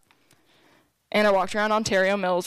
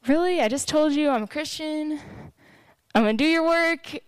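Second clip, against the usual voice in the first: retold higher-pitched incredulous complaint; mock-pleading "how could you" tone; indignant protest; 6 semitones higher than usual. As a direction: protest in disbelief, pitch raised.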